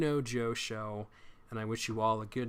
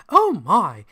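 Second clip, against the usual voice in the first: higher, louder mock British "oh my"; mock British accent; 6 semitones higher, 12 dB louder than usual. Host mocking a guest's British accent, hammed-up. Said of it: "my British accent".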